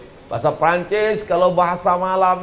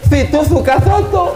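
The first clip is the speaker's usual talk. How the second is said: high, louder mock lisp on soto; teasing friend reply; 9 semitones higher, 4 dB louder than usual. Friend answers the buyer in a playful high voice. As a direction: tease back with a lisping soto. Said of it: "dijawab sama kawannya".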